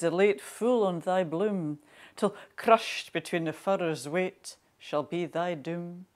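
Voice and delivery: measured, emphatic Scots verse reading; poem recitation. Acted. Poet reads Burns's Mountain Daisy to listeners, solemn recitation. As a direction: recite it line by line, with weight and pauses.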